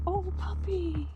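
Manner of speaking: high-pitched, drawn-out coo; cooing at puppy. Real, not acted. Spotting a puppy on the walk, cooing.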